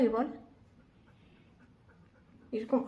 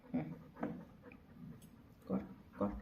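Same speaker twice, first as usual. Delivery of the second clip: whispered coaxing; 17 dB quieter than usual. Real, not acted. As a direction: whisper the coaxing to the dog, breathy and hushed. Coaxing dog to high five.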